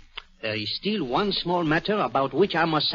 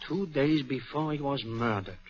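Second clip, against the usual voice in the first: usual speaking voice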